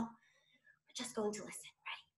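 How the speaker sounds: soft unvoiced whisper; hushed classroom whisper. Shown as a demonstration. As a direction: whisper so the kids lean in. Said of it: "We're not gonna talk at all".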